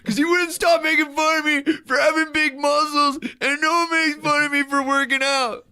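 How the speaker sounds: high, loud defensive whine; teacher whining an excuse to the principal; whiny excuse. Retold or imitated. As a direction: plead your excuse like a sulky kid. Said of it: "And I was like".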